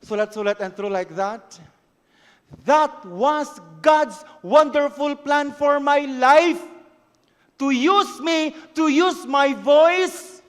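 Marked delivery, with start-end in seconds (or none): 2.7-10.4 high, loud, slow declaration; preaching at full force. Acted. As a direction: proclaim each phrase slowly. Preaching over music.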